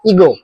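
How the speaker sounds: ईगो with a long ई, as in evening; ईगो, long ee sound at the start; pronunciation demo. Shown as a demonstration. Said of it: "इसको ही pronounce करते हैं इवनिंग की तरह".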